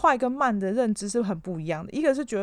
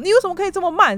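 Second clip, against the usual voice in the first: higher, louder mock tirade, stress on 为; imitated outburst; 10 semitones higher, 6 dB louder, faster than usual. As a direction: boss blowing up like he's shouting it. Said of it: "他就会突然发飙".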